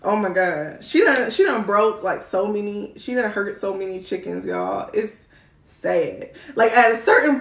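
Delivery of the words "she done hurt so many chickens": ordinary talk, nothing marked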